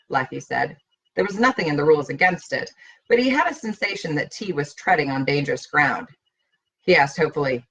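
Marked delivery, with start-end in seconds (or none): none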